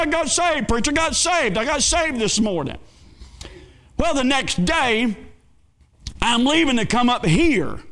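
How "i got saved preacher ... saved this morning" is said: high, excited and beaming; like a man bursting with good news; joyful announcement. Retold or imitated. Roger telling the preacher he got saved, high and joyful.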